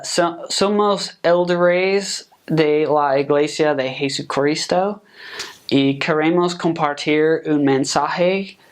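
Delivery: slow, halting Spanish in a heavy American accent; gringo Spanish accent. Shown as a demonstration. New American missionary stumbles through a street greeting, in a slow, heavy gringo Spanish accent. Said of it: "A gringo would be like".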